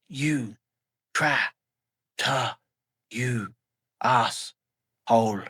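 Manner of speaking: broken syllables, last word slow and dragged; gasping broken insult. Acted. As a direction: spit it out weakly through pain. Injured Vin gasping insult.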